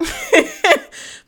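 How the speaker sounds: bursts into a loud high laugh; real laughter breaking out; loud amused laugh at her mom's saying. Real, not acted.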